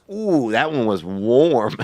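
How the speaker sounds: slow, savouring delivery of a quote; retold passenger reaction. Lady next to him on the plane reacts, mildly imitated. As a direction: lightly imitate a drawn-out reaction. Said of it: "she goes".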